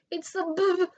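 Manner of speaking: teeth chattering. A narrator's reading. Frightened pig stammering about the wolf, terrified. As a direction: stutter it out, shaking with fright. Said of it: "Boris chattered".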